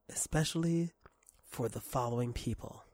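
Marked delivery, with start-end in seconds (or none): none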